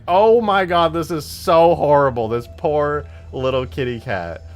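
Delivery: loud horror easing into pity; retold horrified outburst, dropping toward sympathy; freaking out. Streamer recalls seeing his cat covered in pee, horrified. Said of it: "And I'm freaking out".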